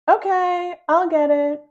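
high, loud call. A narrator's reading. Boy calling to family about doorbell. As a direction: call it out to the house.

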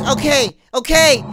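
raging gamer. Real, not acted. Yells surrender at game.

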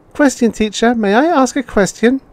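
put-on very high child's voice; a small child eagerly asking; child asking the robot teacher for permission in a very high voice; curious child.

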